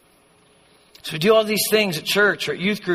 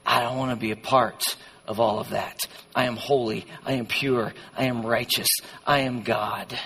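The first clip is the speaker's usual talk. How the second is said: low, soft, measured divine voice; quiet, solemn firmness, word by word; Jesus speaking; 7 semitones lower, 6 dB quieter than usual. Acted. Jesus refusing to share believers with the world, low and solemn. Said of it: "And Jesus says".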